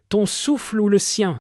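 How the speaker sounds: high eerie voice of the sea spirit; sea spirit speaking. Acted. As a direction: pitch it high and otherworldly. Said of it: "dit-elle". (voix aiguë et surnaturelle de l'esprit de la mer)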